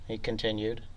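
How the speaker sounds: ordinary delivery, nothing marked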